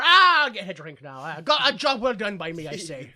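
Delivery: self-satisfied stage Irish, loud opening; mock a pleased Irish policeman; Irish cop accent. Cop heads to the bar after work, mock Irish accent. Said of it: "the Irish police accent".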